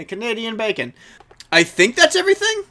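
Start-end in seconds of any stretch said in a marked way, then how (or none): 1.5-2.6 excited. Real, not acted. Wrapping up, sudden loud exclamation.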